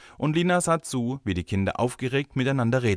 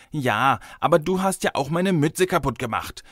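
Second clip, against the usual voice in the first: put-on high, protesting little boy; four-year-old countering; 7 semitones higher, 4 dB louder than usual. A narrator's reading. Four-year-old boy accuses the girl back, indignant. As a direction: hit back with a child's accusation. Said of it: "konterte der Vierjährige".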